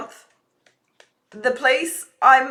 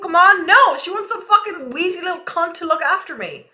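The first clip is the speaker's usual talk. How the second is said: louder, higher angry rant; 5 semitones higher, 5 dB louder than usual. Real, not acted. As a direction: vent it, fed up and offended. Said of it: "I'm ranting now".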